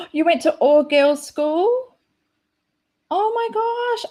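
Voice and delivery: gasping, high-pitched surprise; surprised. Real, not acted. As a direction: caught off guard, genuinely amazed. Reading a surprising chat comment.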